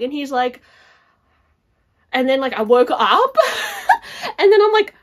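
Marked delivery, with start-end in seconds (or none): 3.4-4.3 burst of laughter. Real, not acted. Laughing at waking up.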